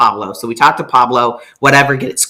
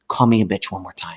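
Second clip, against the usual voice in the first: low, soft, menacing calm; calm and quiet; 7 semitones lower, 7 dB quieter than usual. Retold or imitated. To the girlfriend face to face, low quiet menace. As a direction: say it low and still, a quiet threat. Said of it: "I was really calm".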